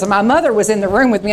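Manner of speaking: usual speaking voice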